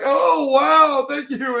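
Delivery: high, loud burst of thanks; mock delighted Hughes. Hughes gushing thanks while dragging Sheska out, high and loud. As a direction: gush like a cheery officer.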